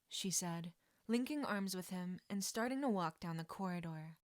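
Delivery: ordinary delivery, nothing marked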